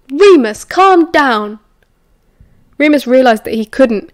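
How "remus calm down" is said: raised, higher voice cutting in; insistent, loud appeal; urging calm. A narrator's reading. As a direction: firmly call him to order. Urging the upset man to calm.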